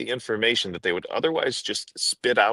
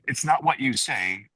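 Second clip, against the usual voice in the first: normal talking voice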